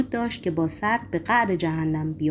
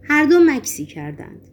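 ordinary delivery, nothing marked

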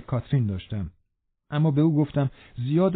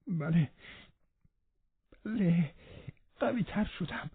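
higher, softer, voice near tears; tearful yes; 6 semitones higher, 8 dB quieter, slower than usual. Acted. Sick boy answering Catherine through tears, higher and softer. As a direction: Linton fighting tears to say yes.

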